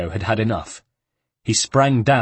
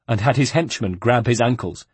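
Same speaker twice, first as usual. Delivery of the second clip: ordinary delivery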